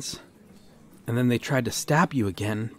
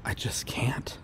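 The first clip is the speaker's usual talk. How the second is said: barely breathed I just can't; whispered refusal; 5 dB quieter than usual. Acted. Officer whispering her refusal to him. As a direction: let it slip out in a whisper. Turning down the proposal.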